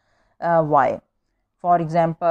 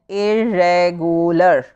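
irregular slowly split into four syllables; 4 dB louder, slower than usual. Shown as a demonstration. Teacher demonstrating four syllables to pupils, irregular slowly broken up. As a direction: draw out every syllable of irregular. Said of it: "there are four syllables".

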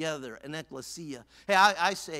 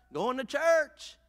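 high, drawn-out answer; calling back; 7 semitones higher, 4 dB louder, slower than usual. Acted. Churchgoer answering the curious neighbor, calling back. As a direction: call it back slowly and proudly.

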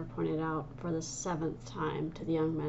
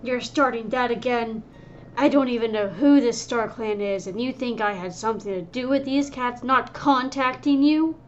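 loud, higher, indignant yelling; angry shout; 5 semitones higher, 10 dB louder than usual. Acted. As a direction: shout back, outraged at the accusation. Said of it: "whirled around with fur rising".

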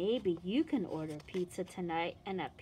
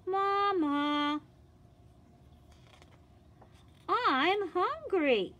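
put-on higher, slow child calling out; 8 semitones higher, much slower than usual. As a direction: call out like a hungry little girl. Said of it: "And she's calling".

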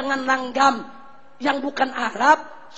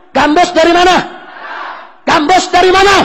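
louder and higher; high shouted question; 5 semitones higher, 9 dB louder, faster than usual. Real, not acted. Preacher shouting the question at the congregation. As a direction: press the crowd for the answer.

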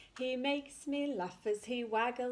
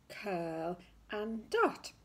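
slow tracing; much slower than usual. Shown as a demonstration. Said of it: "And we go down his body".

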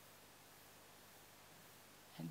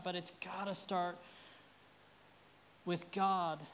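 ordinary talk

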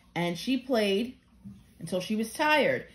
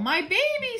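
alarmed cry; 13 semitones higher, 3 dB louder than usual. Acted. Mother finds messy baby.

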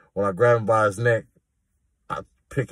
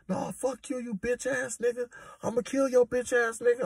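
mock yelling; 13 semitones higher, 6 dB quieter than usual. Inmate staging a fake fight for the guards, high mock yell. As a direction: squeal the threats up high like a fake tantrum. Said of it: "He come out yelling and screaming".

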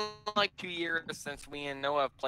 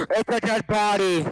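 mock dramatic gasp; 6 semitones higher, 8 dB louder than usual. Mock discovery.

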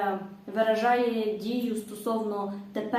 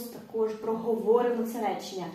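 normal talking voice

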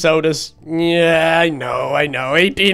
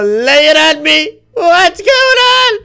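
much higher, slow drawn-out plea; anxious whine; 11 semitones higher, 5 dB louder, slower than usual. Acted. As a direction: beg for the news, dreading it. Awaiting dental results anxiously.

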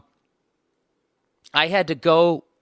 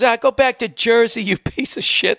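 higher, louder mock tough-guy taunt; heckler's taunt; 8 semitones higher, 4 dB louder than usual. Heckler tells the comic to go back to Jersey, mocking. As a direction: sneer it in a put-on jerk voice. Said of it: "and then he goes".

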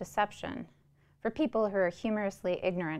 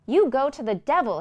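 put-on louder, higher furious outburst at the old man; angry cry; 7 semitones higher, 11 dB louder than usual. Runaway boy lashing out at Mister Shiflett, furious shout. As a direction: fling it at him, half shouting. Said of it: "he cried".

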